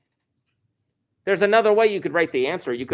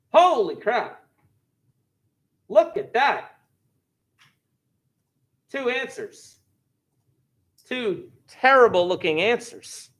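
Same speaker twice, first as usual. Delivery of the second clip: higher, amazed exclamation; surprised; 8 semitones higher, slower than usual. Real, not acted. Teacher amazed by the graph's two answers, higher surprised exclaim. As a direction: caught off guard by the result.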